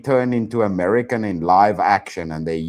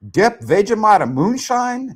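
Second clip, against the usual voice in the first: incredulous; 10 semitones higher than usual. Real, not acted. Incredulous listing.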